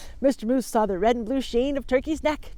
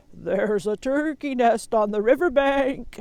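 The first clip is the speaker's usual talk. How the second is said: slow, louder sheep call; 2 semitones higher, 5 dB louder than usual. Acted. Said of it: "Sheep called".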